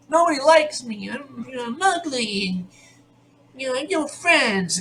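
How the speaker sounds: high-pitched mock whine; exaggerated self-pitying wail, imitating a girl; whiny complaining girl. Imitating a girl complaining to MacGyver about her life, whiny. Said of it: "she starts complaining about her life".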